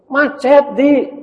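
put-on high, loud lady's voice asking the boy; rich mother asking. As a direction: lean out of the car and ask. Classmate explains.

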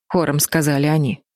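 ordinary delivery

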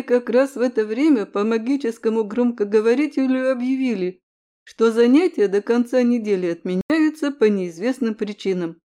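ordinary delivery

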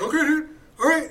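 high, easygoing mock agreement; laid-back hippie. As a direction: shrug it off, backing away. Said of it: "He's like".

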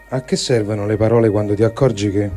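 ordinary delivery

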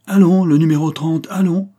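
a harsh guard bellows to fetch prisoner thirty; brutal guard's shout. A narrator's reading. Guard calling the convict away, brutal shout. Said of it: "dit la voix brutale du garde Chiourme".

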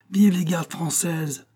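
slow, high-pitched acclamation; crowd's cheer. A narrator's reading. People cheering the French Guards, drawn-out high cry. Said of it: "cria le peuple".

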